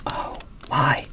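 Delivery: ordinary talk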